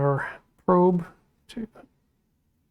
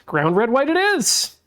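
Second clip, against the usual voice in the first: excited; 6 semitones higher, 4 dB louder than usual. Real, not acted. Decides the wire colours.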